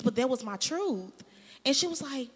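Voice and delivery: ordinary talk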